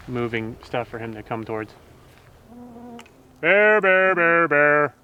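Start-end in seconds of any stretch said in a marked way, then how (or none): 3.4-4.9 loud firm calls; scaring off a bear. Real, not acted. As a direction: call it out to warn the bear.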